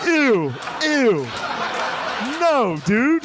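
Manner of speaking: high, drawn-out comic revulsion; mock disgust. Congressmen reacting to the first proposal, high-pitched mock disgust. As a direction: recoil in exaggerated disgust. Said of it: "And everyone's like".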